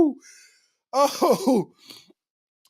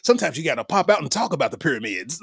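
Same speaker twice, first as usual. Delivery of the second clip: mock lecturer voice; 9 semitones lower, faster than usual. Mock voice of history buffs.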